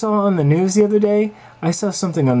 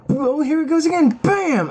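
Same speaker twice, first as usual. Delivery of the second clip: ordinary delivery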